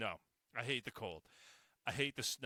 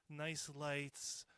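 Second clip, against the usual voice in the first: normal talking voice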